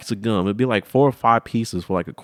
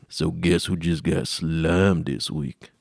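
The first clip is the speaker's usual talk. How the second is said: put-on slow kid brag; 3 semitones lower, much slower than usual. Kid self to Jared.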